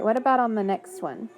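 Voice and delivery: ordinary talk, nothing marked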